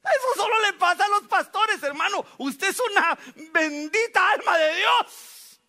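high, loud fervent exclamation; preaching shout. Real, not acted. Pastor calls out to the congregation, loud and high. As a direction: cry it out to the brothers, slowing on the blessing.

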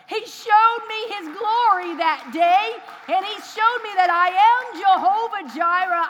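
loud, slow, high preacher's cry; fiery preaching. Real, not acted. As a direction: proclaim it with all your force. Preacher builds to full force.

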